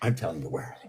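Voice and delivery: hushed confiding whisper. Real, not acted. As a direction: drop to a secret whisper. Voice sinks into a whisper.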